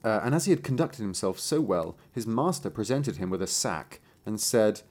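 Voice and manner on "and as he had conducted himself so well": usual speaking voice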